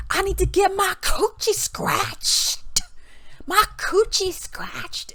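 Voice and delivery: high, stretched-out raspy squeal. Acted. Mimicking a rapper in London, high mocking squeal. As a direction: screech it out in mockery, dragging the last words.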